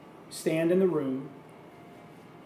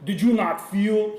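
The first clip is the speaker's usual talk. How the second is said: put-on Russian accent sliding into Schwarzenegger, louder; higher, hammed-up mock-Russian accent; bad Arnold; 4 semitones higher, 5 dB louder than usual. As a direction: attempt a Russian, land on a poor Austrian accent. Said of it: "That's like a bad Arnold impression".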